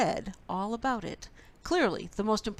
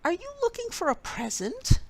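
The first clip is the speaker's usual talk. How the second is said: much higher, lilting suspicion; high voiced question; 14 semitones higher, 4 dB louder than usual. A narrator's reading. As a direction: ask it in a high teasing voice. Bear asks suspiciously.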